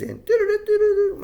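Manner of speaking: high-pitched sci-fi "doo-doo" beeping; goofy electronic noise by mouth; imitated robot noise. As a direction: make silly space-robot bleeps, high and loud. Mimics dorky space and robot noise.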